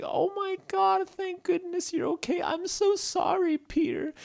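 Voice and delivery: very high, squeaky relief; high-pitched weepy apology; tearful Stella. Acted. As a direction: gush tearfully in a tiny high voice. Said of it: "was in tears".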